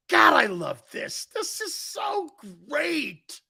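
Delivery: high, slow, gleeful shout; delighted outburst. Real, not acted. Excited reaction to a tweet.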